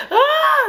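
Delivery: very high, loud squeal; imitated shriek. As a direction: let out a sudden high shriek. Imitates girls' reaction to police.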